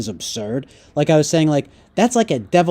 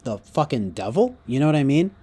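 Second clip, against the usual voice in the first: usual speaking voice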